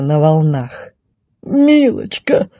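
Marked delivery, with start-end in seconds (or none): 1.4-2.5 high, slow, pleading whine; knight's long, high-pitched plea; desperate moan. Acted. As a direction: moan it out in despair. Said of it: "отчаянно простонал рыцарь".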